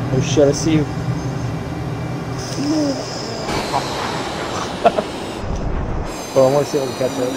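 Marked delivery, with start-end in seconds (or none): 0.9-6.3 long, high-pitched cackle; real laughing fit. Real, not acted. Chasing a friend's plane, real high laughing fit. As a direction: crack up helplessly, high and breathless. Cracking up during the flight game.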